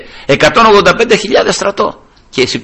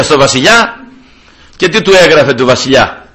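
normal talking voice

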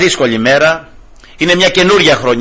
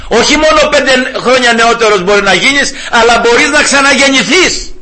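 joyful preaching; 9 semitones higher than usual. Retold or imitated. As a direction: lift him up with joyful zeal. Preaching to a shop customer. (χαρούμενο, δυνατό κήρυγμα σε πελάτη του μαγαζιού)

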